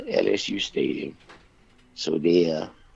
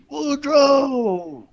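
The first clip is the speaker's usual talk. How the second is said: high, louder long calls of the name; hollering across the stadium; 6 dB louder, much slower than usual. Retold or imitated. Thibodeau calls his friend across the stadium, long high holler. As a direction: bellow the name across the field, drawn out. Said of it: "Thibodeau started hollering".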